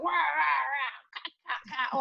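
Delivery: imitated kids' play noises; toy sound effects. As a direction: make battle noises like boys with toys. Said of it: "all these sound effects".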